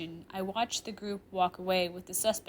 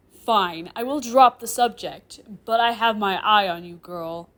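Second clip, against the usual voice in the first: loud, grudging, glaring threat; angry warning; 11 dB louder than usual. Acted. Professor glaring close at a student, angry. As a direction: concede angrily and warn. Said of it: "I say, glaring angrily".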